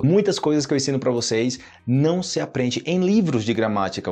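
ordinary talk

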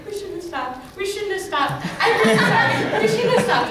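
high mock panic building from normal volume to loud; panicked regret. Acts rising panic and regret.

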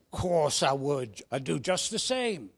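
put-on Cockney boy starting low and slow; Cockney accent. Second boy reassuring his devoted pal, low-to-middling Cockney. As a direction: assure your pal in Cockney, starting deep. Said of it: "Should I put on a Cockney accent".

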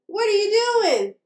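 high, curious little-mouse call; storybook call to a friend. A narrator's reading. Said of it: "called Sam and Pip".

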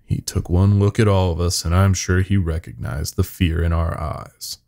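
ordinary delivery

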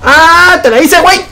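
loud, high victory shout; triumphant yell. Real, not acted. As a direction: yell it out after winning the fight. Triumphant yell after a play. (grito de victoria, muy alto y agudo)

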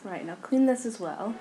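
usual speaking voice

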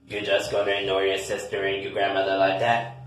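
low, quick reproach; reproachful older relative. Acted. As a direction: scold in a low, soft voice. Low-voiced relative character scolds.

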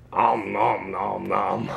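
low, slow mock eating sounds; playful nom-nom. Dad mimics baby eating, low slow noms. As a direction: chew out the noms lazily.

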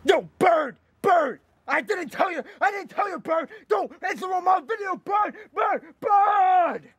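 real exasperated high shout; yelling at the bird. Real, not acted. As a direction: yell across the room to silence the bird. Yelling at noisy bird off-camera.

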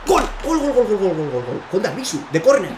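loud high chanting of gol; goal celebration shout. Real, not acted. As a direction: roar the goal. Goal scored. (grito de gol)

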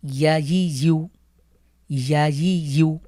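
ইয়া ই ইউ read slowly and clearly; recitation drill. Shown as a demonstration. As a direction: read ইয়া ই ইউ for learners to follow. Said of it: "একসাথে পড়ি". (একসাথে ধীরে ইয়া ই ইউ)

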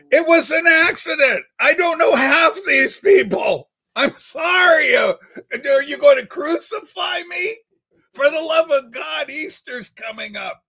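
high, loud mock panic apology; frantic fake apology to the chat; hammed-up pleading. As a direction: beg for mercy, shouting high and loud.

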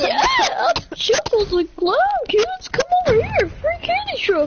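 high, fast hammy clown voice; silly clown. Pretend. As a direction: lure the kids like a creepy clown. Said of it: "Do you know how to do a clown voice?".

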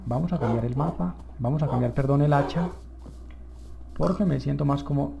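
ordinary talk

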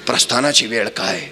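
ordinary talk

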